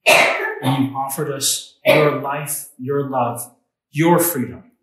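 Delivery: soft, unhurried pastor's prayer voice; closing prayer. Acted. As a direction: pray it gently for the congregation.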